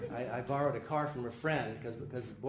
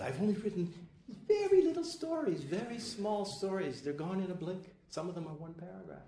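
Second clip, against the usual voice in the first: higher, lightly voiced old writer; mild Borges voice; 8 semitones higher than usual. Quoting Borges on his tiny stories, lightly voiced and higher. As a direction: give the old writer's modest reply.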